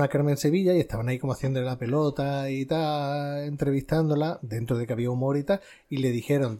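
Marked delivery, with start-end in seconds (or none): none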